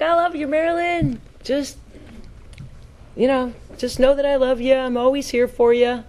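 a hint of a sweet old friend saying he loves her; mild mock of friend. Retelling a kind old friend.

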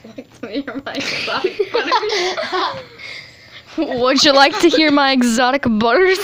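giggling mock question; laughing. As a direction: laugh through the words. Mocking friend's line while laughing.

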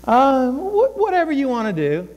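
permissive Jesus. Retold or imitated. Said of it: "he's gonna say".

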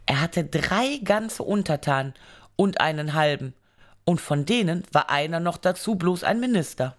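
ordinary delivery

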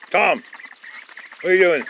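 loud high call to an animal. Real, not acted. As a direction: call the turkey from afar. Calling out to the turkey.

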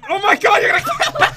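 high, loud shriek through laughter; panicked laughing shriek. Real, not acted. Player to opponent closing in for the kill, laughing shriek. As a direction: shriek as if about to be caught.

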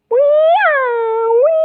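high, loud drawn-out "uya"; whooping martial-arts cry; mock kung-fu yelp; high battle cry. Battle cries while fighting in game.

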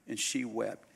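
ordinary delivery

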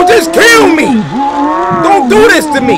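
loud, high pleading; desperate yelling. Real, not acted. Player pleading not to have this done to them, desperate yell. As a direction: beg at the top of your voice.

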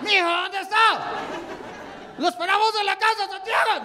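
very high, strained parental call; yelling for a son. Acted. Dad hunting his son in a bar, yelling. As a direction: call out like a dad searching a club. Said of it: "Yo me puse a gritar".

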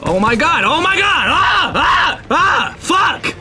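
high frantic yells; real startled shrieks; panicked screaming. Real, not acted. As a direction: scream as you get ambushed. Being killed in game.

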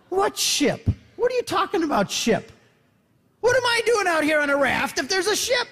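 high, incredulous questions; a convert on a raft learning of the ship, astonished. Acted. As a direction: sound thrown by the news, pitched high.

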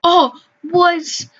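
sudden high shocked yelp; surprised. Real, not acted. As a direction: caught off guard by the price. Shocked by in-game price.

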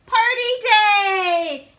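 slow, high and loud, drawn out with joy; excited announcement. A narrator's reading. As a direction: stretch it out like the best day ever. Party day arrives.

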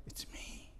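hushed, unvoiced whisper; Jesus whispering into the storm, unvoiced. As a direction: breathe it close like a secret. Whispered reveal.

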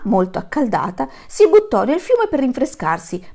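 plain reading voice

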